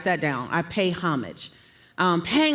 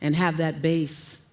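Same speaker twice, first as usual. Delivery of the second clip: going down low; 6 semitones lower, 3 dB quieter than usual. Shown as a demonstration. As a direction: lower pitch, down into the bass. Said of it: "comfortable enough to go down".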